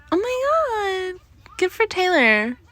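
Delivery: fangirl delight; delighted squeal; gushing. Real, not acted. Gushing over Taylor.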